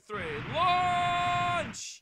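high excited shout. Real, not acted. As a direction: yell it out for the launch. Countdown then launch.

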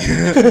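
high, loud quick laugh; a short real laugh at her own joke; sudden laugh. Real, not acted.

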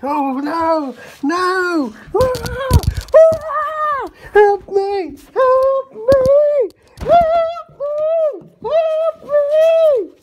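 high shrieking cries for help; terrified screaming. Pretend.

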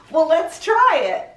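plain reading voice

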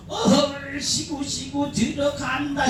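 a hint of the Gyeongsang crowd calling out; imitating Gyeongsang women. Mimicking Gyeongsang women at the show, light high call. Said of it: "이러면".

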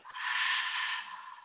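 long audible sigh out; releasing breath out. Shown as a demonstration. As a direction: let the breath go with a slow sigh. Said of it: "and a releasing breath out".

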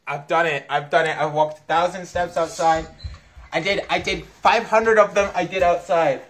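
loud triumphant announcement; excited. Real, not acted. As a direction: proclaim it proudly, loudly. Triumphant announcement after finishing.

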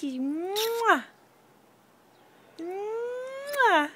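long high smacking kiss; drawn-out playful mwah; kiss sound. Shown as a demonstration. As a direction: model the kiss, lifting it up. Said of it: "Give me one kiss".

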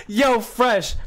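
bursting out laughing. Real, not acted. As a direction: laugh through the words. Laughing at teammate's death.

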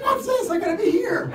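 high, loud startled outburst; like waking up to a bent arm in shock; shocked disbelief. Retold or imitated. Waking from surgery with his arm stuck out, loud shocked surprise. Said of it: "I'm like".

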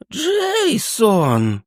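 put-on high, trembling old man's cry of awe; awed old man. Old man recognizing Jason, high awed cry. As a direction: breathe the name in reverent wonder, high.

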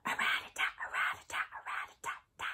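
soft whispered drum roll; whispered snare drum. Shown as a demonstration. Teacher to kids, whispering a snare drum. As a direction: rattle it out in a whisper. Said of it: "So it kinda sounds like this".

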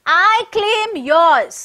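loud, high, slow declaration; booming angry proclamation; thundering anger. A narrator's reading. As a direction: thunder it out, stretching each word. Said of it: "Thundering, that means an expression of anger".

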